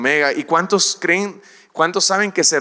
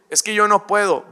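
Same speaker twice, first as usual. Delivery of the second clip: higher, pleading excuse-maker; whiny excuse; 6 semitones higher, 4 dB louder than usual. Acted. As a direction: beg off like a timid believer. Believer makes excuses to God.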